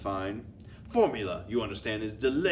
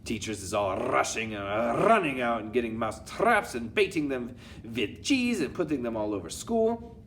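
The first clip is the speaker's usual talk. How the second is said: animated villain witch with punched-up words. Acted. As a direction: revel in the mayhem, rolling the key words. Witch's speech in the story.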